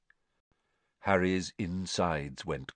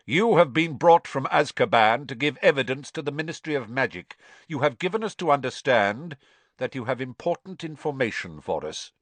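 a hint of a curt, formal judge; measured ministry authority, lightly voiced; stern official; 6 semitones higher, 4 dB louder than usual. A narrator's reading. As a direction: Crouch addressing the accused coldly. Crouch questions the prisoner.

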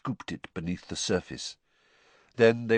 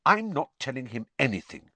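short; 6 semitones higher than usual. A narrator's reading. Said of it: "Hermione said shortly".

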